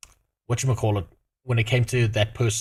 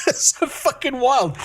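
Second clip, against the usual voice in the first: higher, slow, laughing through the words; 12 semitones higher than usual. Real, not acted. Streamer marvels at the tenant's nerve, laughing through it. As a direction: let the laugh carry the line.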